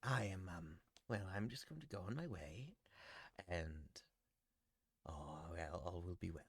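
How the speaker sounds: a lightly voiced, soft old Archbishop. GM voicing the Archbishop leaving for his family home, soft and courteous. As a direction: play him quietly and courteously.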